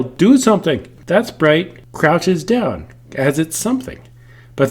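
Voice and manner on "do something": raised, high-pitched mock yell; loud pretend command; demonstrated yell. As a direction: yell it as a playful example. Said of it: "when you yell".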